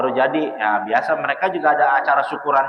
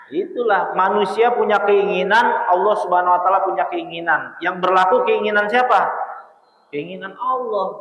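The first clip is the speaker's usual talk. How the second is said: higher-pitched, emphatic sermon delivery; preaching; 5 semitones higher than usual. Real, not acted. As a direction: drive the moral home to the congregation. Preaches a moral to listeners. (berceramah dengan nada tinggi dan tegas)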